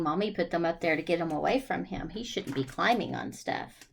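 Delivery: usual speaking voice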